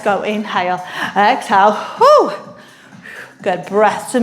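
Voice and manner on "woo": high whoop of encouragement; excited woo. Real, not acted. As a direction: cheer the class on. Cheering mid-workout.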